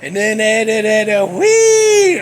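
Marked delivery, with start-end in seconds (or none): 1.3-2.2 high, loud squeal; mock pig squeal. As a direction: squeal like a pig, imitating the film. Replays the squeal from film.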